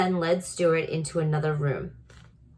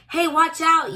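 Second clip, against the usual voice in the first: high, loud startled yell; yelled warning; 11 semitones higher, 6 dB louder than usual. A narrator's reading. Dentist yelling as the toy car shoots off. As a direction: shout the warning in alarm. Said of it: "yelled Doctor Carey".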